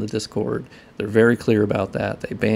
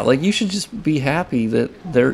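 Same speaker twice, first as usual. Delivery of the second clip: normal talking voice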